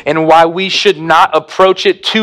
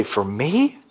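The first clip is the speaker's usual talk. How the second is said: much softer, small questioning voice; hushed wonder; 6 semitones lower, 11 dB quieter than usual. Retold or imitated. Listener's surprised reply to the preacher, much softer. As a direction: ask it in quiet disbelief. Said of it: "you would say".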